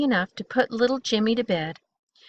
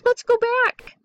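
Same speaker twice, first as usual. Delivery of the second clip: put-on high, squeaky little-boy plea; scared child voice, pitched way up; anxious and high, not truly hushed; urgent stage whisper; 13 semitones higher, 3 dB louder than usual. A narrator's reading. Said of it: "whispered Jimmy".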